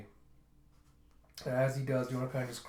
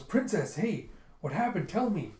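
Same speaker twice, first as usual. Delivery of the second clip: higher, urgent acted plea; alarmed concern; 7 semitones higher, 4 dB louder than usual. Man running up to crying princess, alarmed. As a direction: call out to her with worry.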